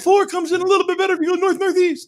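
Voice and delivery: high, fast voice calling up. Retold or imitated. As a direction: shout directions up to the roof. Mimics someone calling up to Dad.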